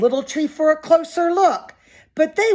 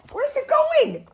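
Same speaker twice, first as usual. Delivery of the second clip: much higher, eager wondering question; excited question. Acted. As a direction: ask it with wide-eyed excitement.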